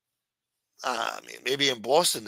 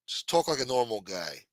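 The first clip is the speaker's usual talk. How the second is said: normal talking voice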